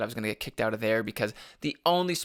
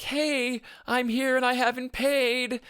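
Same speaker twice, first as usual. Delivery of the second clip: mock announcement; 12 semitones higher, slower than usual. Voicing the street lamp's exposure.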